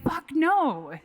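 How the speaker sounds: emphatic refusal. Retold or imitated. Refusing husband's documentary idea.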